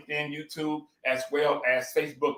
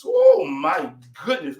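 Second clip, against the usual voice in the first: excited; 6 semitones higher, 6 dB louder, slower than usual. Real, not acted. Tasting the guacamole, delighted.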